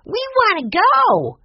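put-on very high, loud child's squeal, drawn out; excited exclamation. A narrator's reading. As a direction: cry out like a thrilled little boy. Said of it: "Benny exclaimed".

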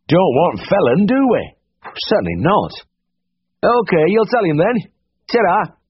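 broad, chatty Dales farmer voice; Yorkshire farmer. Acted. Farmer leaving a message for the vet, broad Yorkshire. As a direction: lean on the railings and chat like a farmer. Bert Sharp farmer dialogue.

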